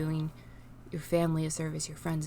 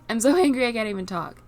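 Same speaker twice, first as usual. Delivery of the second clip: real anger spilling out louder and higher with a tense laugh; angry; 5 semitones higher, 7 dB louder than usual. Real, not acted. Vlogger venting about selfish people, angry outburst with a laugh. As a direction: frustration bursting through a nervous laugh. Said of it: "And I feel angry right now".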